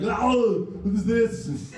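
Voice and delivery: casual Arnold on the phone, light Austrian; Arnold impression. Comic imitating Arnold answering the phone, light Austrian accent. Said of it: "I called Arnold".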